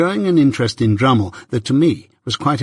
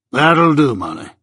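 Jaggers, dismissive; 3 semitones higher than usual. A narrator's reading. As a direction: dismiss her with a falling close.

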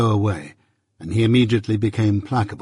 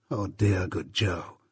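tender; 4 semitones lower, 9 dB quieter, slower than usual. A narrator's reading. Narrator's tender memory.

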